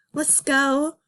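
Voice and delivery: ordinary delivery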